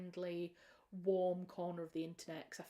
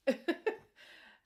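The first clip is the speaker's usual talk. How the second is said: breathy laugh; 10 semitones higher, 8 dB louder than usual. Real, not acted. Laughing after a slip.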